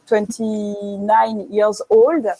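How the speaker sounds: put-on slow, careful English accent read of the ad line; deliberate English accent quoting the brand. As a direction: read the English tagline slowly with a French speaker's English accent. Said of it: "Pardon pour mon super accent".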